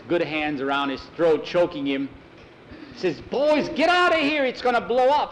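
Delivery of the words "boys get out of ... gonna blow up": high, loud panicked order to flee; urgent warning. Acted. Nikolai warns boys before the blast, urgent shout. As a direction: shout it high to clear the room.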